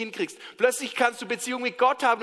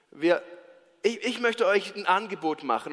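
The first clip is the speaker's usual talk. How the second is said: ordinary talk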